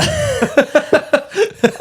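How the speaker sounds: high, helpless laugh. Real, not acted. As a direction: crack up laughing. Laughing at teasing co-host.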